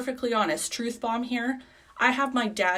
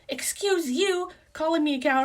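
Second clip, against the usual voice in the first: mock offended dad; 8 semitones higher than usual. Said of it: "And he's like".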